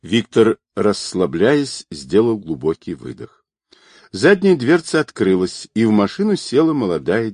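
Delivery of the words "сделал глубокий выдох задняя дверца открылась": usual speaking voice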